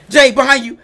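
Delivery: urgent shout. Real, not acted. Warning friend of monster.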